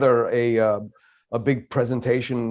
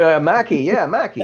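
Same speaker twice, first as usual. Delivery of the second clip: higher, louder Boston accent Mackie; 6 semitones higher, 6 dB louder than usual. Shown as a demonstration. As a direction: say the name the Boston way. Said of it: "Say it in the Boston accent".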